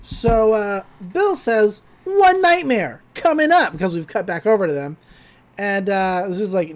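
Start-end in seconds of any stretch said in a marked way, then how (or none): 2.0-3.7 high, slow, gleeful villain line; villain announcement. Retold or imitated. Bill announcing a nightmare for the twins, slow and high. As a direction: savour it like a cartoon villain.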